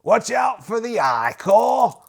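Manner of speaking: loud, slow shouted warning; captain's warning. Acted. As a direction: bark it like an officer. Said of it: "The captain says".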